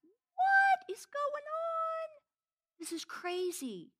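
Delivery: disbelief. Retold or imitated. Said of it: "and we're like".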